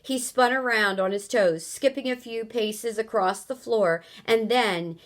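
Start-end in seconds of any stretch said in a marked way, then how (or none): none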